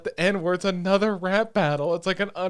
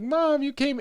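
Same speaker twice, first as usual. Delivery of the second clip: high, childlike mock squeal of delight; little kid mode; 10 semitones higher, 3 dB louder, slower than usual. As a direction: lightly play her as an excited little kid. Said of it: "little kid mode".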